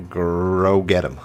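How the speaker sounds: usual speaking voice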